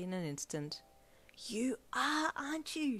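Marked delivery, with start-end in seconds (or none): 1.5-3.0 high, excited gasp of a question; gasped surprise. A narrator's reading. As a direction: gasp it out as you guess the secret. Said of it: "she gasped".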